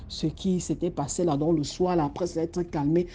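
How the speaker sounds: ordinary delivery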